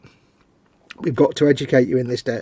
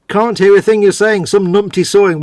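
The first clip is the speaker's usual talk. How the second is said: shouting over noise; 7 semitones higher, 8 dB louder than usual. Real, not acted. Complaining over loud sawing noise.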